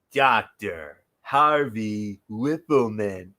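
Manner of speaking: slow, drawn-out sarcastic intro. Retold or imitated. Ring announcer mocking the manager, sarcastic. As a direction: drag the name out with mock respect. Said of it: "in a sarcastic tone".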